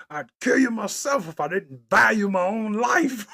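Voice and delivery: half-laughing mock villain line; lightly voiced disgusted villain, breaking into laughter; villain quote. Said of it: "he was mad at himself".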